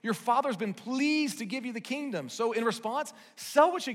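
lightly voiced Jesus, coaxing; eager urging. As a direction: urge them warmly to let go. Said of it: "He says".

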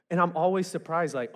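usual speaking voice

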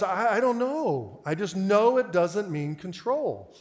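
slow, stammered start, drawn-out words; haltingly stubborn, stretched out; hesitant insistence. Retold or imitated. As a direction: imitate an unsure caller digging in. Retold caller hesitates, then insists.

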